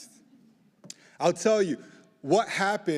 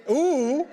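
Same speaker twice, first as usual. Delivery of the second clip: high, loud laughing ooh; a happy squeal at the applause; delighted squeal; 11 semitones higher, 7 dB louder than usual. Real, not acted. Speaker to a cheering crowd, laughing squeal of delight. Audience cheers him warmly.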